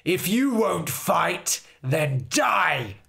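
loud, slow, final threat; furious Fairy King. Acted. Fairy King threatens Harold before striking, loud and slow. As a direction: hurl the death sentence.

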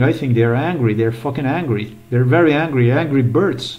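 ordinary delivery

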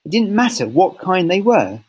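ordinary delivery

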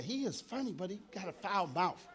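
mock a disapproving old woman; a hushed church-lady complaint; prim old lady. Old women quoted again.

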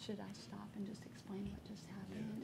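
very soft private question; hushed aside. Real, not acted. Judge checking before explaining to the audience, hushed. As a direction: ask colleagues quietly, not the room.

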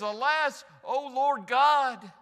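loud, high, drawn-out wail of dread; alarmed cry. Acted. Preacher acting Gideon realizing he has seen the Lord, alarmed cry. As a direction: cry out as if doomed.

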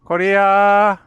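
loud high call to the dog. Real, not acted. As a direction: call the dog across the yard. Calling dog loudly.

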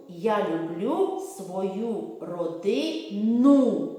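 very slow, every word drawn out; sing-song rhyme; slow drill. Shown as a demonstration. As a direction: model it slowly for children. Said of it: "Повторюйте".

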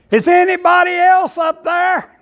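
a high, loud shout upward; a man hanging from a cliff shouts for another helper; calling out. Retold or imitated. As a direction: holler it up the cliff as if someone else might answer.

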